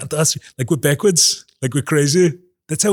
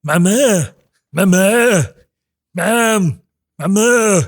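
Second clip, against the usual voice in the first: a lightly voiced cartoon baby calling, a bit higher and louder; Stewie impression; 4 semitones higher, 4 dB louder, slower than usual. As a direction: keep nagging for mom as the cartoon baby. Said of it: "That's the best I can do".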